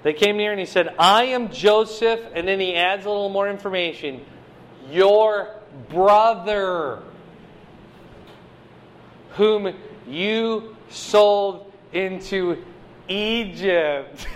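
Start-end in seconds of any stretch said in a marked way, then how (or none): 1.0-2.2 lightly acted Joseph, slow and louder; a touch of slow, weighty declaration; dramatic reveal. 4.9-14.3 very slow, word-by-word dramatic delivery; hammed-up slow revelation; drawn-out reveal. Drawn-out mock-dramatic reveal by Joseph.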